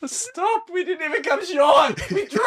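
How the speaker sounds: high laughing shout; laughing protest. Real, not acted.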